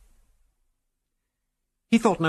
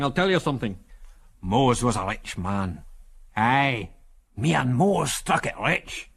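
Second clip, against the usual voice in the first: put-on old Scotsman, broad Scots accent; broad Scots character voice; strong Scots accent. A narrator's reading. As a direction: speak as a hospitable old Scot, broad accent. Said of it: "in a strong Scots accent".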